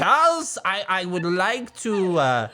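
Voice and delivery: hammed-up prim old British man; Jon Lovitz. Parent imitates daughter showing a thing, like Jon Lovitz. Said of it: "the reincarnation of Jon Lovitz".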